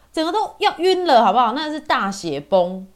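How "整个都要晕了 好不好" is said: much higher, louder and fast; dramatic exclamation. Retold or imitated. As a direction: exclaim it like you nearly fainted. Seeing blood on her chair.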